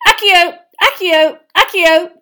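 loud, high repeated command; angry shouted summons; shouted incantation. A narrator's reading. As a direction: fire off each spell as a furious shout. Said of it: "she shouted".

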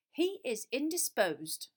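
slow, clipped-off dismissal; curt teacher shutting it down; short and curt. A narrator's reading. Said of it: "said Professor Grubbly Plank shortly".

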